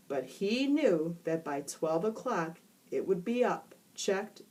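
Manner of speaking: ordinary delivery, nothing marked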